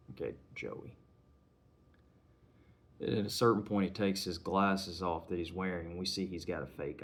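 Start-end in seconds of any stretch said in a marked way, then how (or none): 0.1-1.0 whispered, low and knowing; menacing hushed taunt. Retold or imitated. Gangster quietly taunting Tom with his real name, in a whisper. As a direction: hush it like a quiet threat.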